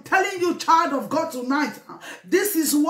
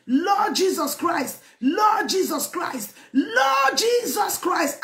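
tearful fervent prayer; slower than usual. Real, not acted. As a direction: call on the Lord, letting tears break through. Repeated fervent invocation, weeping.

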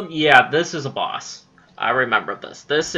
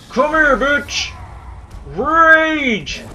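battle yell; 9 semitones higher, 7 dB louder, much slower than usual. Real, not acted. Raging attack on boss.

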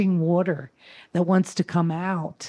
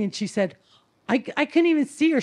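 usual speaking voice

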